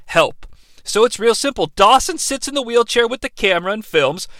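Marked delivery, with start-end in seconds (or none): 0.1-0.5 genuine plea. Retold or imitated. Cliff asking Dawson for help, softer and earnest. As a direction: plead for help with quiet sincerity. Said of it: "the Cliff character is genuine".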